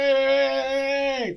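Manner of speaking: a high, hammed-up cheer from his mates; mock cheer. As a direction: throw out a big mock party cheer.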